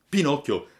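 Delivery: ordinary delivery, nothing marked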